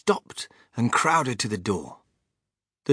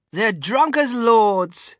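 gleeful; 10 semitones higher, 7 dB louder, much slower than usual. Acted. Wonka delighted.

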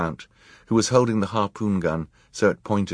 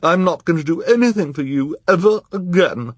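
pouting; 11 semitones higher, 6 dB louder than usual. A narrator's reading. Esme continues pouting.

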